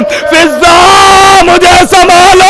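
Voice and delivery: high, loud, slow wailing call; anguished cry for help. Acted. The wounded lady calling her maid, wailing loud and high. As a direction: cry out her name in agony. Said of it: "बस एक मर्तबा बीबी आवाज देती है".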